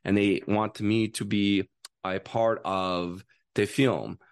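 put-on Kazakhstan accent, slowing at the end; halting English in a Kazakh accent. Shown as a demonstration. Actor showing the director his film accent, Kazakhstan accent. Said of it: "their accent is like".

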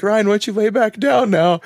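hint of a nurse coaxing a patient down; nurse soothing. Nurse telling the waking patient to lie back down, lightly voiced. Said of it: "he needs to go back to sleep now".

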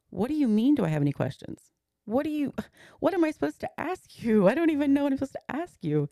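stunned, high-pitched disbelief at the doctor; disbelieving outburst. Retold or imitated. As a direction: say it as if the question makes no sense, voice shooting up. Stunned wife answers doctor's question.

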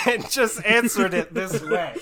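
high, loud, slow words through laughter; helpless laugh breaking into the sentence; laughing while talking. Real, not acted. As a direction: crack up while finishing the thought. Cracking up at own joke.

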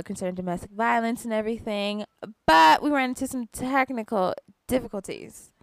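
usual speaking voice

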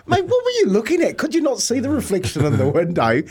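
high squeaky disbelief, laughing through it; high-pitched laughing disbelief; incredulous mock cry. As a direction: squeal it in disbelief at his brother. Laughing at brother's blunder, inner thought.

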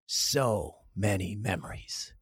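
low, slow announcer read; commercial voiceover. Shown as a demonstration. Voiceover over the bike commercial, slow low ad read. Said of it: "Here's your voiceover part".

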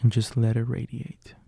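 calm, unhurried guide voice with long pauses; guided visualization. Acted. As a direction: lead the listener gently through the image.

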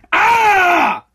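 a raw, high yell of frustration; frustrated yell. Real, not acted. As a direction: let out a loud fed-up shout. Frustrated outburst mid-rant.

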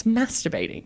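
"masturbating" with a short a, as in cat; softly corrective "masturbating", short a in the first syllable; correcting pronunciation. Retold or imitated. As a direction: quote a friend's correction, "masturbating" starting like "mass". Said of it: "You don't say the word correctly".

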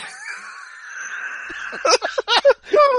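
shrieking out of control; high shrieking laugh. Real, not acted. Helpless laughing fit.

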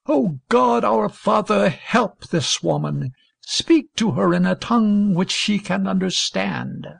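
sincere prayer. A narrator's reading. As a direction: pray it from the heart, deliberately. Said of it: "gasped out the briefest and sincerest prayer".

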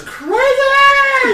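high, loud, long-drawn exclamation; exasperated outcry. Real, not acted. Friend complaining about bills, crying out in loud exasperation. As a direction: wail it high and stretch out crazy.

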